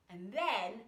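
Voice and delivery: ordinary delivery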